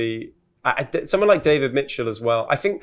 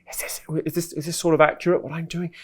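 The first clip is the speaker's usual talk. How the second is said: Louis Theroux whisper; 5 semitones higher than usual. Retold or imitated. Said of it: "there's the sort of whispery bit that he does like that".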